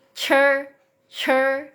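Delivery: "cer" with an added h puff, held high; slow, high "cer" with a breath after the ch; "cer" as Mandarin chi, vowel like a schwa; aspirated chi. Shown as a demonstration. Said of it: "apa, hanya gitu ya".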